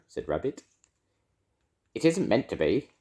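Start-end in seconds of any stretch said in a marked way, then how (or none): 1.9-2.9 higher put-on voice; disguised voice. A narrator's reading. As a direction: insist in a feigned voice. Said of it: "in a different sort of voice".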